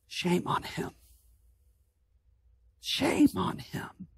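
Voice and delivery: whispered shame on him, breathy and low; hushed scorn. Acted. Preacher voicing the scornful crowd, in a whisper. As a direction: hiss it under the breath, mocking.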